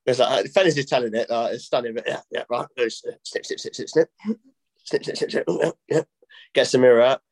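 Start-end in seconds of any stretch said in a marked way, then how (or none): 3.5-5.4 quick, hushed snipping noises; imitated scissor snips. Joke teller acting out the barber cutting hair, imitated snipping. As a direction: make the scissors with rapid whispery snips.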